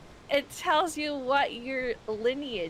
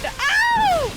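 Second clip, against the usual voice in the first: sudden high scream of fright; jump-scared shriek; startled yelp; 13 dB louder than usual. Real, not acted. Jump scare, she yelps.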